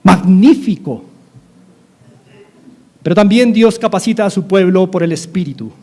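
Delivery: ordinary talk, nothing marked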